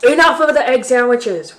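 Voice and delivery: loud roaring bear. Acted. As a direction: roar it to end the talk. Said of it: "roared the bear".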